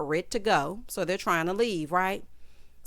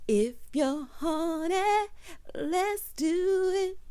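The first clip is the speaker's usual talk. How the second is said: slow, drawn-out pickup line; mock come-on; 9 semitones higher, much slower than usual. Host mimicking the man's come-on, slow and hammed-up. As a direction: stretch every word out like a cheesy seduction.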